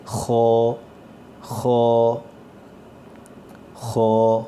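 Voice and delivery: خو with a thick, rough sound from the back of the throat; not خا but a heavy خو; heavy kha. Shown as a demonstration. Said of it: "آواز تھوڑی سی موٹی ہونی چاہیے". (خو موٹی آواز کے ساتھ)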